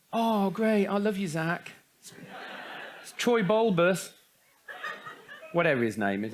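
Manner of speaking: usual speaking voice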